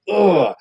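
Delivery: disgusted ugh. Retold or imitated. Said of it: "something wrong makes me go".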